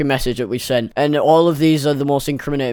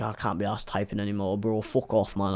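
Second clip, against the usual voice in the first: bored mumble; 7 semitones lower, 13 dB quieter than usual. Retold or imitated. Bored inner voice quoted.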